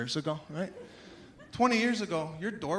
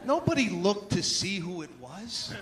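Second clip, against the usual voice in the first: ordinary talk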